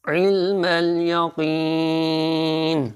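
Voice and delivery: slow melodic tajweed recitation; slow melodic Quran recitation. Shown as a demonstration. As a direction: chant it slowly, each syllable drawn out. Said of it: "Urdu تلفظ دیکھیے".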